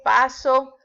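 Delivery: "paso" stressed on PA, loud and clear; stress on first syllable. Shown as a demonstration. Teacher showing unaccented present-tense verb, stressed "PA-so". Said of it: "Cuando lleva acento es en pasado".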